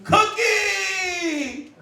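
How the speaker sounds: high cheering shout of a name; kids cheering. Retold or imitated. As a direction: yell it like a crowd of kids. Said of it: "all the kids said".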